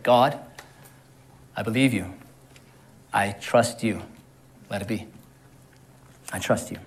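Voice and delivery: low, hushed prayer with long pauses; soft, reverent, falling low; quiet trust. Acted. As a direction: murmur it softly, surrendering to God. Abraham's trusting reply to God.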